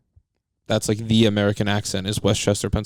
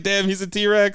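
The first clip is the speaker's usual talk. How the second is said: higher, laughing through the line; laughing quote; 8 semitones higher, slower than usual. Retold or imitated. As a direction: laugh as you mimic yourself. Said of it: "I was like".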